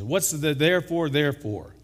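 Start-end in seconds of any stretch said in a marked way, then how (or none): none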